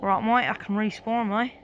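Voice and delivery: loud, hammed-up Aussie accent; put-on Australian accent. Shown as a demonstration. Gamer having another go at the accent, broad mock Australian. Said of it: "can't do that Australian accent".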